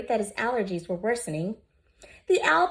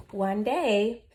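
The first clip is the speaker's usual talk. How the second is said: ordinary delivery, nothing marked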